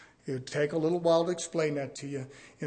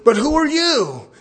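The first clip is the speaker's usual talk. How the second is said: the devil's mocking challenge, higher and louder; louder taunt; 11 semitones higher, 12 dB louder, slower than usual. Devil mocking the exorcist, loud and higher. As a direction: throw the question back with a sneer. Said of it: "And he says".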